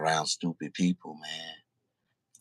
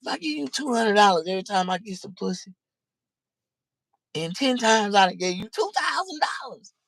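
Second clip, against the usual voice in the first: imagined scolding; 14 semitones higher than usual. Retold or imitated. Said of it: "I be like".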